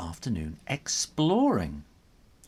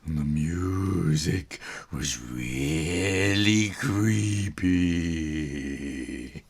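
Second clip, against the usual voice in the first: low, slow eerie storytelling; slow low creepy telling; spooky narration; 5 semitones lower, much slower than usual. A narrator's reading. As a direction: build dread slowly. Spooky movie description.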